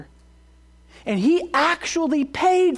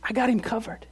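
reassuring Jesus; 5 dB quieter than usual. Acted. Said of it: "who says".

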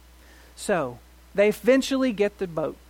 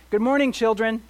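calling out; 5 semitones higher, 6 dB louder than usual. Pretend. Preacher calls out greeting.

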